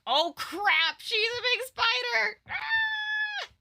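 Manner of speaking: shrieking in mock horror; high startled cry at a giant spider; mock fright. Retelling the spider reveal with a mock shriek.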